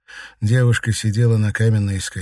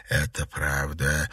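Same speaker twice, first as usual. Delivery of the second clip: low, slow, soft confirmation; composed, unmoved statement; calm; 6 semitones lower, 5 dB quieter, slower than usual. A narrator's reading. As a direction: confirm it evenly, without hurry. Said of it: "спокойно подтвердил Темнейший".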